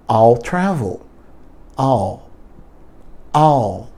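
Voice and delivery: "I'll" with a long dark L; stretched L sound. Shown as a demonstration. Teacher drilling students on "I'll", drawn-out L. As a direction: lengthen the L in "I'll", barely a vowel. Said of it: "Você só aumenta o L".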